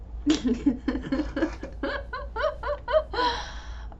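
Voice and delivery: long high-pitched giggling fit; laughing fit. Real, not acted. Player cracking up at the game, long high laugh. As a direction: giggle helplessly, high and drawn out.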